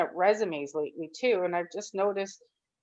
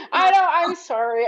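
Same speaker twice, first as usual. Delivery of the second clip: laughing through tears; 9 semitones higher, 5 dB louder than usual. Real, not acted.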